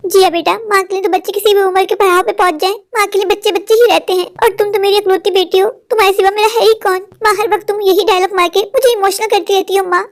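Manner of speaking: ordinary delivery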